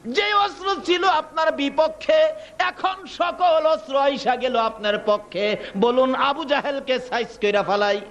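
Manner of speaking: a commander calling up to his leader; army commander's appeal. Acted. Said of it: "সৈন্যর সেনাপতি দাঁড়ায়া বলেন". (সেনাপতির উঁচু গলায় আবেদন)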